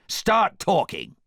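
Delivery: loud, slow pirate demand; drawn-out menacing order; Captain Hook. A narrator's reading. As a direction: threaten him into confessing. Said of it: "cried Captain Hook".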